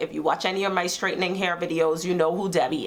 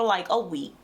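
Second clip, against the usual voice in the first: ordinary talk, nothing marked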